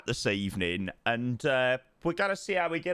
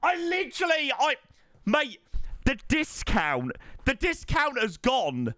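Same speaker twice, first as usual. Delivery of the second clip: stunned disbelief; 9 semitones higher than usual. Real, not acted. Shocked by gifted subs.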